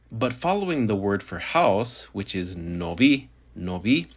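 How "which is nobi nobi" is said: ordinary talk, nothing marked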